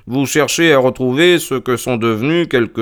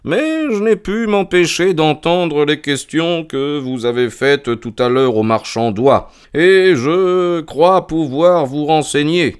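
slow, honeyed politeness; 7 semitones higher, slower than usual. A narrator's reading. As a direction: coax him smoothly, taking your time. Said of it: "dit Holmes mielleusement".

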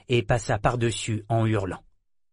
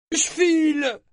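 panicked pirate; 3 dB louder, slower than usual. Acted. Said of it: "annonça un pirate".

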